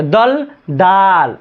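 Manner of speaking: दल short, दाल with its आ drawn out slowly; very slow contrast of दल and दाल; short then long vowel. Shown as a demonstration. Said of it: "अब दाल में कई मात्रा के".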